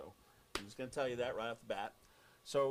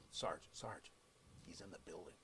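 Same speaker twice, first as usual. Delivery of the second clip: low, much softer conspiratorial "he's in the building"; hushed tip-off; 7 semitones lower, 12 dB quieter than usual. Retold or imitated. Host whispering the news to his partner on air. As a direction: drop to a secret murmur.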